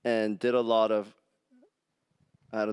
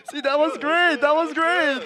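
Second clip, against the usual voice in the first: high, loud laughing praise; 13 semitones higher, 6 dB louder, slower than usual. Real, not acted. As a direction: laugh through the compliment. Laughing praise after song.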